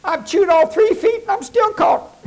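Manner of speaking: high, loud, exasperated critter voice; high, loud wail of a coon who did it all wrong; exasperated coon punchline. Acted. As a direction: squeal the punchline like a frantic raccoon.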